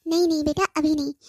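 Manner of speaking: normal talking voice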